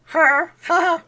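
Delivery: very high, loud cartoonish laugh; failed attempt at a squeaky character laugh; Pee-wee Herman. Streamer failing at Pee-wee for viewers, high character laugh. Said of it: "I can't do Pee Wee".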